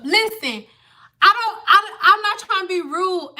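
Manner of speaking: usual speaking voice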